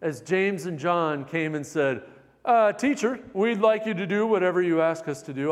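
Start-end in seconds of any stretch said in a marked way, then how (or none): 2.4-5.5 mock British accent. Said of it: "slightly British accent when they said".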